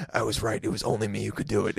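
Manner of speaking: low, hushed mock TV-hero murmur; Kiefer Sutherland. Imagining the original star watching the bad remake, imitated low whisper. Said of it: "In his whisper, like".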